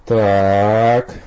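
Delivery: drawn out. A narrator's reading. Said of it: "протянул Олег".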